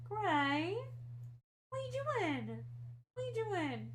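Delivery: high cooing questions; asking the cat in a high pet voice; pet talk. Real, not acted. Talking to the cat.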